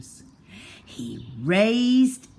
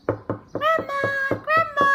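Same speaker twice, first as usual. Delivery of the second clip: put-on very high childlike voice; little girl; 3 dB louder than usual. A narrator's reading. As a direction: call sweetly like a small girl. Girl at the door.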